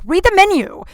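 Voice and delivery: loud, high burst of exasperation; exasperated mock yell. Bartender to customers who won't read the menu, exasperated yell.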